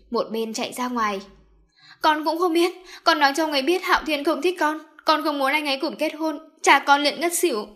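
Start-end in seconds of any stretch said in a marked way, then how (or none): 2.0-7.7 higher, louder, tearful rush; sobbing. Acted. Daisy sobbing to her mother on the phone. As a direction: cry it out, heartbroken. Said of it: "Daisy khóc vô cùng thương tâm".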